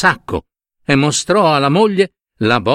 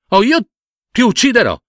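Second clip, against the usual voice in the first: high-pitched menacing ultimatum; angry threat; 11 semitones higher, faster than usual. Acted. Man aims his bow at python, high angry threat.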